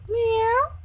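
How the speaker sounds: very high playful meow; mock meow. Real, not acted. Meowing at the cat.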